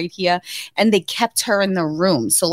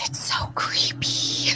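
slow, hushed whisper; 8 dB quieter, slower than usual. Retold or imitated. Husband whispering to his wife about surgery, slow whisper. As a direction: breathe it out slowly. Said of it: "he goes".

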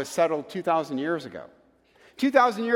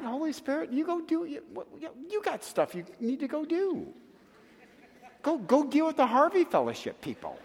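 high-pitched, halting brush-off; comic retold sulky dismissal; mock dismissive; 9 semitones higher than usual. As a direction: shoo them away in a high voice. Retold brushing off the Holy Spirit.

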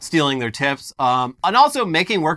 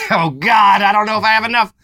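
boss voice; 8 semitones higher, 8 dB louder than usual. Pretend. Acting boss counting pennies.

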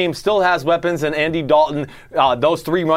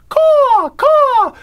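bird caw; 5 dB louder than usual. Acted. Seahawk cry imitation.

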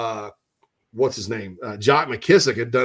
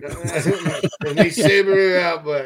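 put-on slow, drawn-out Australian accent through laughter; mock Australian; 6 semitones higher, 4 dB louder, much slower than usual. Host repeating his Australian joke, slow hammy Australian accent. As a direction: stretch it out in a hammy Aussie voice, laughing. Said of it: "mine is".

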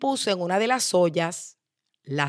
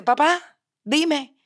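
higher-pitched daughter pleading for an explanation; lightly amused, pitched-up appeal; 7 semitones higher, 3 dB louder than usual. Acted. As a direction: question dad in a raised, playful voice. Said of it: "se ríe y le dice".

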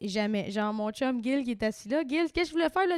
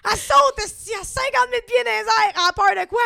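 much higher and louder, incredulous; excited mock outrage; 8 semitones higher, 7 dB louder than usual. Real, not acted. Cohost teasing herself about her fear of wind, loud mock outrage. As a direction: tease her fear with big disbelief.

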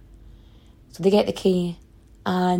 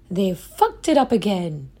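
usual speaking voice

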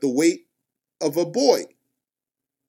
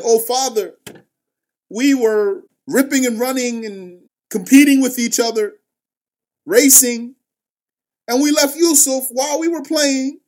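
higher, louder, eager excuses pouring out; pleading brothers; 7 semitones higher, 4 dB louder than usual. Acted. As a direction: sell the lie with urgent eagerness. Brothers pleading their lie.

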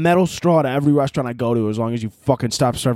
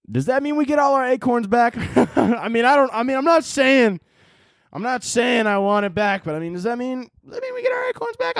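much higher, squeaky sheepish asking; squirrel pleading; 10 semitones higher than usual. Acted. A squirrel asking his poker buddies for the acorns back, high and sheepish. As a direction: plead timidly for your winnings.

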